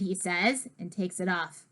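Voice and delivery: ordinary delivery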